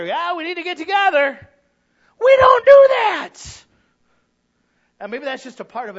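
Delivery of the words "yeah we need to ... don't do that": loud, high, slow shout; shouted frustration. Acted. Preacher confessing missed coffee meetups, shouted frustration. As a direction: blast the confession at them.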